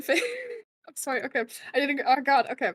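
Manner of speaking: ordinary talk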